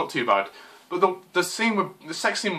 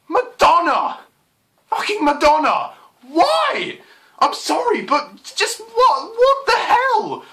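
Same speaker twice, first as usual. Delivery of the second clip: much higher, louder exasperated shouting, breaking into laughter; outraged disbelief; 14 semitones higher, 5 dB louder, slower than usual. Real, not acted. Ranting to viewers about [name]'s casting, shouting in disbelief. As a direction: yell it in disbelief, half laughing.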